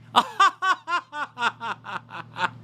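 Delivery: very high posh titter; hammed-up snooty giggle; a pretend millionaire's laugh; fake rich laugh. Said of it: "I'm working on my rich laugh".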